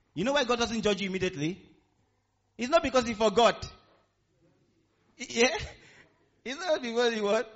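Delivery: loud, high, driving preacher voice; full-force preaching. Real, not acted. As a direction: hammer it home to the congregation, high and loud.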